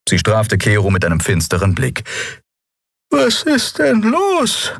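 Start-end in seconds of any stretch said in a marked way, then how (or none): 3.1-4.7 very high, slow worried voice; a frightened woman asking slowly; anxious healer voice. Acted. Xea anxious at the door.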